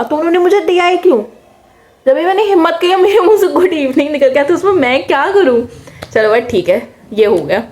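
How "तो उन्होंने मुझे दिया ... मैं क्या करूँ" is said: much higher, exasperated "what could I do?"; indignant protest. Real, not acted. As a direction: plead your case, outraged at the unfairness. Indignant complaint about teacher.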